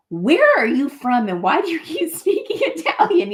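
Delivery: hammed-up incredulous questions; imitated puzzled locals. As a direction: imitate baffled locals, half laughing.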